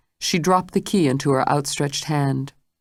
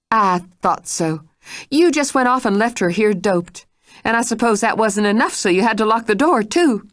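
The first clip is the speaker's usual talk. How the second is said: higher, pressing and quickening; angry accusation; 6 semitones higher than usual. Acted. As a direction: accuse her point by point. Mrs Stitt angrily accuses.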